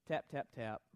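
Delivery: mock the weak effort, barely bothering; half-hearted taps. Imitating Joash striking the ground half-heartedly, limp. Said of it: "Joash just kinda goes".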